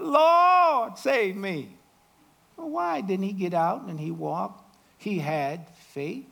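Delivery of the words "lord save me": desperate cry. Acted. Peter sinking, cries out.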